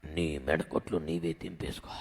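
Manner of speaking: God telling the captive to break free himself. Acted. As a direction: say it deep and low like a stern God. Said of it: "ఏమంటున్నాడు?".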